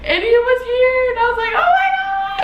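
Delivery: the moment he arrives, high and loud; overwhelmed joy. Real, not acted. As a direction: voice shooting way up on oh my God. Baby is born.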